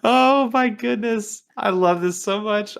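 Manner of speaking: high, slow, giggly exclamation; dying of laughter; squeaky laughing delight. Real, not acted. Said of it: "I am freaking dying".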